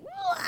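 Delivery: very high mock crying wail; hammed-up crying wail. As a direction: burst into a comic sob. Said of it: "just tears everywhere".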